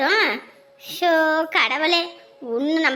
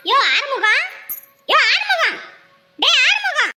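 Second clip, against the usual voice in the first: calling husband; 5 semitones higher than usual. Acted. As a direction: yell his name to wake him. Wife mockingly calls husband by name.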